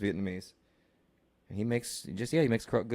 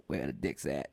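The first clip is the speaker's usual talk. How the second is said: much lower, quieter mock rapper growl; 11 semitones lower, 7 dB quieter than usual. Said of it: "and he's just like".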